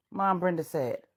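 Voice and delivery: ordinary delivery, nothing marked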